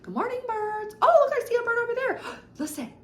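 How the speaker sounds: sing-song delight as if greeting birds; teacher playfully greeting birds for a toddler; playful high voice. Shown as a demonstration. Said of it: "change your tone".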